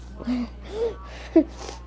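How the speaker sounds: high breathy giggle breaking through; can't hold back a giggle; laughing mid-eulogy. Real, not acted. Giggle during mock eulogy.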